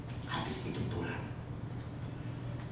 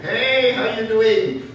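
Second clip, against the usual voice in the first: much higher, very slow, loud friendly greeting; imitating the elder; 13 dB louder, much slower than usual. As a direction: play a cheery American elder.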